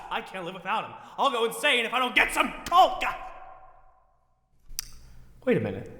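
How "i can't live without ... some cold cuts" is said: high-pitched panic, swelling at the end; hysterical despair. Acted. Shopper facing a holiday without cold cuts, hysterical despair. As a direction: work yourself up toward hysteria. Panicking about going insane.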